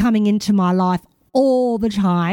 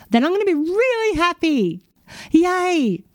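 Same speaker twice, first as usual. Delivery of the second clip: mock cheer; 8 semitones higher than usual. Mock cheer at imagined happiness.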